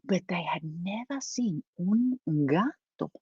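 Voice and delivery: soft, trailing to a whisper; hushed storytelling. A narrator's reading. Storyteller tells how the mice had never seen a gato, hushed. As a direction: hush it down as if sharing a secret.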